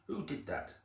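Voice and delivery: mildly indignant squirrel voice; cross. A narrator's reading. Squirrel to whoever knocked it out of bed, mildly cross. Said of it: "asked the squirrel crossly".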